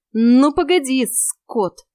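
mock-threatening shout; shouting after him. A narrator's reading. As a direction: yell after the fleeing cat. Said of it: "крикнула я".

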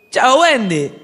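high, loud, delighted call; bright shout of a boy seeing a friend; joyful boyish greeting. Acted. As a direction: burst in with a happy hello. Peter greets Wendy joyfully.

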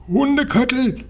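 very high witch screech, contained volume; shrill, high-pitched squawk. A narrator's reading. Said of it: "kreischte sie".

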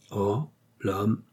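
a soft, put-on voice of distaste; disgusted. A narrator's reading. Meillan dismissing mankind to the countess, soft and disgusted. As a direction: sniff the word away in disgust. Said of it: "d'un air dégoûté".

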